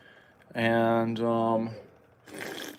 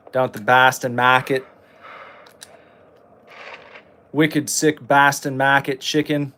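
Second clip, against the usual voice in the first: put-on Boston accent. Gamer pitching chicken wings in a mock Boston accent. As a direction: ham up a Boston local.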